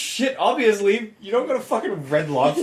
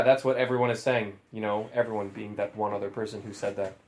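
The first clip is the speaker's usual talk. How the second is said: ordinary delivery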